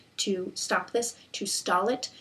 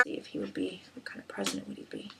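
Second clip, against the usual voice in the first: near-whispered, voiceless murmur; murmuring to oneself; 3 semitones lower, 16 dB quieter than usual. Real, not acted. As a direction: mutter the question under your breath. Murmurs question to herself over cards.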